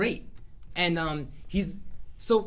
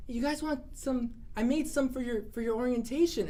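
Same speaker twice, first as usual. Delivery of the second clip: higher, a lightly voiced little old lady; sweet old lady; 7 semitones higher than usual. Old Myrtle offering cookies to the party, higher sweet granny voice. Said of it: "this old lady".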